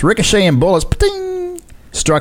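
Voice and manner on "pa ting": high ricochet ping; ricochet sound. Pretend. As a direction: make a bullet ricochet noise. Ricochet bullet sound effect.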